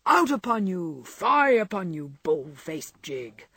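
a hammed-up prim scold, ending slow; mock Cock Robin scolding. Laurie mocking silly girls as Cock Robin, hammed-up scold. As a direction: wag a mock finger in an old-fashioned voice. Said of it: "I always want to say with our friend Cock Robin".

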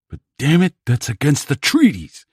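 loud, high, furious snarl. A narrator's reading. Plumber rails at the enemy's broken treaties, snarling loudly. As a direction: spit out the list of broken rules in rage. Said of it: "snarled the plumber".

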